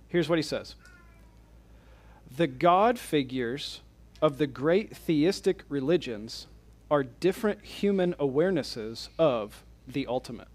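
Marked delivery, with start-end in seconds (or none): 2.3-10.5 slow, measured quote with pauses between phrases; slow reading. Real, not acted. Lecturer reading a quote without slides for the class, slowly. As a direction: read it out slowly and deliberately. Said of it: "I'm gonna read these slowly".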